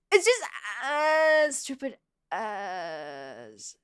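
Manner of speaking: long groaned complaint, trailing down; drawn-out grumble. Real, not acted. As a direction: drag out each ADS as a long whiny groan. Drawn-out grumble about ads.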